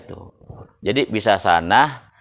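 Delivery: ordinary delivery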